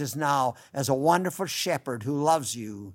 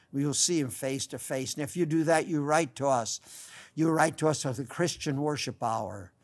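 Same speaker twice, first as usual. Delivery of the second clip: ordinary talk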